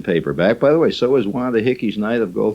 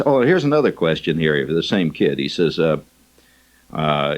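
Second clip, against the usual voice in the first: ordinary talk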